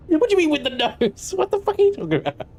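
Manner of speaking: very high, squeaky mock outrage; mock indignation. Streamer answering a jibe about his nose, mock indignant squeal. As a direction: squeal in pretend offence. Mock offence at chat's remark.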